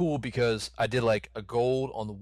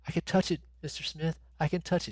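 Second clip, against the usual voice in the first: put-on lightly higher, small boy's voice; childlike boy voice; 7 semitones higher than usual. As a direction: play the frail dying boy reaching out. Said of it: "He's like".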